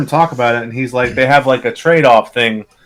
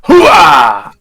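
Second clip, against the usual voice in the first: louder gung-ho hooah cry; loud gung-ho soldier; 6 dB louder than usual. Pretend. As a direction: answer back with a soldier's yell. Answering the Pacino hooah salute.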